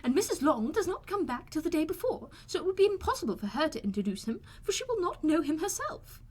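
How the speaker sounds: high, peevish mother complaining; peevish Mrs Bennet. A narrator's reading.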